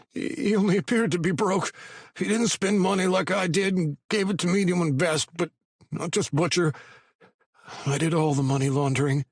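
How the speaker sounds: high, anxious pleading voice; a scared informant talking fast; nervous young man. A narrator's reading. Patty voiced high and frightened.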